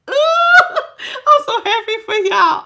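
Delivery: high, slow, laughing through it; laughing with joy. Real, not acted. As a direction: gush happily through a laugh. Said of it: "My cheeks is like".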